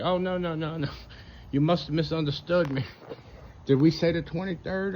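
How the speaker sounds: high, mock-innocent backpedalling; mock pre-tribber pitched high. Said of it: "they're like".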